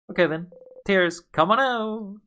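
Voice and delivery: high, drawn-out call; mock summoning. Siffrin urging their own tears out, mock summoning. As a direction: coax it out with a high, slow cheer. Acts calling on tears to come.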